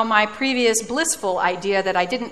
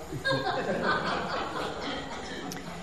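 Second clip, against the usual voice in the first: soft breathy laugh; 5 semitones higher, 13 dB quieter than usual. Real, not acted. Laughs at own reaction.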